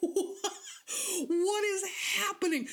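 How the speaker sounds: high, laughing disbelief at What is happening; baffled laughing. Retold or imitated. Listener baffled by silly actor, high laughing bewilderment. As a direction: react stunned while giggling.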